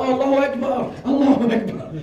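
high, comically fervent praise; naive gratitude. Retold or imitated. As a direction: act out clueless delight at being blessed. His naive grateful reply, retold.

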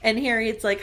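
ordinary talk, nothing marked

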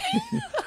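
high laugh. Real, not acted. Laughing at own story.

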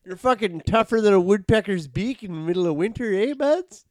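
high, loud hammed-up Canadian accent ending on eh buds; mock Canadian. Mocking Canadian bedroom talk, high Canadian accent. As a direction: lay the Canadian on thick. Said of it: "Canadian sex talk is incredible".

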